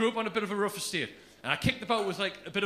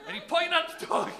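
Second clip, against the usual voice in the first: usual speaking voice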